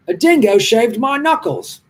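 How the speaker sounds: high, slow mock-Aussie voice; horrible Australian accent. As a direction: ham up a terrible Australian accent. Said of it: "Horrible accent".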